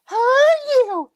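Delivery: put-on very high, drawn-out little-girl squeal; Marty talking like she's twelve. Sister phoning her younger brother, exaggerated twelve-year-old voice. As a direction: imitate a gushing sister in piercing falsetto. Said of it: "She talks like she's twelve".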